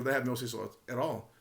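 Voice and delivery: imitated answer, played down and soft; low-key answer. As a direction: say it quietly as the milder alternative. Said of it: "he could have said".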